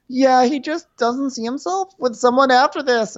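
high, put-on girlish voice; hammed-up high bearer of bad news; mock whiny girl. [name] passing on a rejection to Paige, mock whine.

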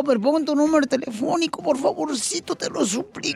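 ruego suave y meloso; suplicando. Acted. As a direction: pide por favor casi implorando. Suplica a los oyentes. (súplica suave y melosa)

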